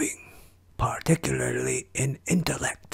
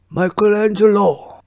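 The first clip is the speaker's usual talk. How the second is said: calling out; 8 semitones higher, 10 dB louder than usual. Acted. Master calls out to pupil.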